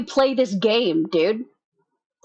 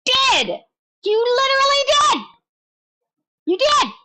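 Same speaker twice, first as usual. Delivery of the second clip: gleeful yelling; 4 dB louder than usual. Real, not acted. Gleeful taunting at streamer.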